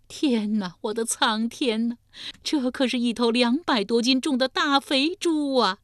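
put-on high-pitched wailing old woman; 呼天抢地地哭诉. A narrator's reading. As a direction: lament loudly to heaven, pitch climbing. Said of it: "呼天创地地地说". (老妇呼天抢地，高声哭诉)

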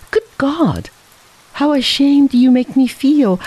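high-pitched exclamation of self-reproach; ashamed outburst. A narrator's reading. Man shamed by the boy's offer of money, exclaiming. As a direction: burst out, pitch lifted, ashamed.

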